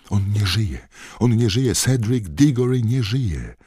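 high, loud, building panicked shouts; crowd shouting. Acted. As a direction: shout the news into the night.

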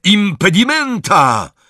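high, loud, drawn-out incantation; piercingly high battle cry; yelled spell. A narrator's reading. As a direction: scream out the spell, stretching it. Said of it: "i wrzasnął".

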